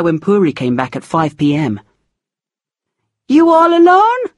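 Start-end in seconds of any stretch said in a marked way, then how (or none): 3.3-4.2 Puri's voice. A narrator's reading. Puri voiced, high far.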